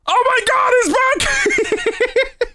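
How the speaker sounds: high laughing shout; excited shout. Real, not acted. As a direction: yell in delighted surprise. Something suddenly returns on stream.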